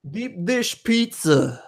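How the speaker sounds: put-on slow, high Chicago accent; drawn-out Chicago voice. Joining the Chicago accent bit.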